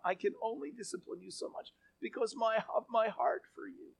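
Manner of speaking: high, hushed voice of anguish; soft, pained reluctance; torn, pleading. Acted. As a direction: plead softly, as if unable to go through with it. God voiced as torn, unable to destroy.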